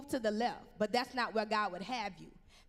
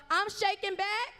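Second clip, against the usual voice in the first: preacher's rallying call; 7 semitones higher, 4 dB louder than usual. Acted. Preacher calls congregation to repeat.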